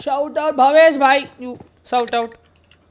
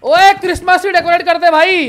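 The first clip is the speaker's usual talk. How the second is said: loud, higher-pitched order to a teammate; calling out; 6 semitones higher, 4 dB louder than usual. Real, not acted. Gamer ordering teammate to the Christmas tree, calling out. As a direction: call it across the game.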